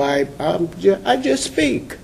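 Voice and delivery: usual speaking voice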